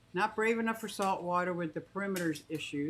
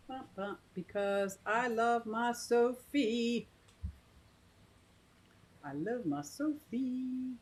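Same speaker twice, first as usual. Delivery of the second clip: cooing; 4 semitones higher, slower than usual. Real, not acted. Cooing to pet bird.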